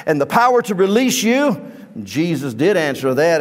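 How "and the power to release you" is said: higher, fast, indignant challenge; indignant Pilate. Acted. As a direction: demand it like an offended governor. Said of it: "Pilate got indignant".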